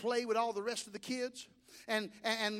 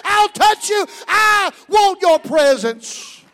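roaring, slow preaching cry; full-voiced pulpit shout; preaching at full force; 9 semitones higher, 19 dB louder, much slower than usual. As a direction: shout it out over the congregation.

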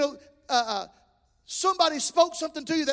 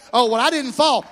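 loud, scoffing mock protest; mock excuse; 9 dB louder than usual. Preacher mocking a churchgoer who claims he never fell, loud and scoffing. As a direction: play the proud churchgoer brushing it off.

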